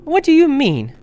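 loud, high alarmed demand; frightened. A narrator's reading. Lucy jumps up to question the fawn, frightened. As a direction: cry out in rising panic. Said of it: "feeling rather frightened".

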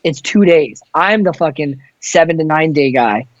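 usual speaking voice

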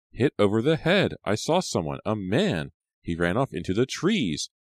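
high, distressed account; trembling voice. A narrator's reading. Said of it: "her voice trembling".

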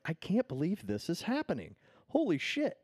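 high-pitched mock amazement; amazed outburst, rising to a high peak; retold excited disbelief. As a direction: relive the giddy disbelief, voice climbing high. Retold thrill at airing ad.

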